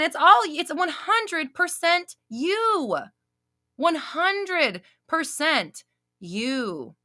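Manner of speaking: every word of one hundred percent you dragged out slowly; slow emphatic stress. Real, not acted. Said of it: "Okay, I like that".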